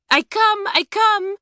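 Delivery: high, loud excited French call; very high, loud hurrying call. A narrator's reading. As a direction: call up the stairs eagerly. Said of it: "she called to Claudine".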